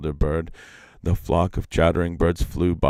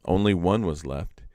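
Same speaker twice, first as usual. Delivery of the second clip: plain reading voice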